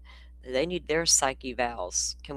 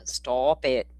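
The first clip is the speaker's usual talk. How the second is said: usual speaking voice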